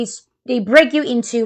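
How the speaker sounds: ordinary talk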